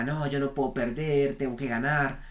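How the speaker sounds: a mild mock protest from a stubborn ego; a trader voicing his own ego, lightly mock insistent; the ego crying out. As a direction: lightly voice the ego refusing to lose. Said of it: "el ego grita".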